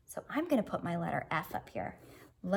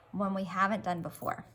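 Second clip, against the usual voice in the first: normal talking voice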